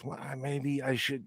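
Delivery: soft, slow musing to himself; tempted inner voice. Retold or imitated. Husband voicing his tempted thought, soft and slow. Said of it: "a twinge of curiosity".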